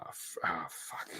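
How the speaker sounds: a breathy whispered swear under the breath; hushed frustrated whisper; whispered curse. Real, not acted. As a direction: swear quietly to yourself while trying to remember. Muttered curse while trying to remember.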